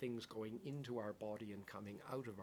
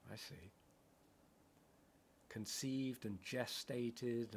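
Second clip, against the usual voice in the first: meditative poem reading; 4 dB louder, slower than usual. Acted. Poetic dharma recitation.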